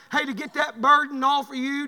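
ordinary talk

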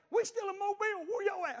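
high, loud, whiny daughter's voice. Retold or imitated. Preacher voicing his daughter calling from Mobile, fretful and high. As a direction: pitch it up into a fretful girl.

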